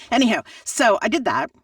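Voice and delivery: ordinary talk, nothing marked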